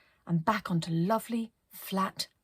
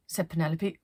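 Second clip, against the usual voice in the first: ordinary delivery, nothing marked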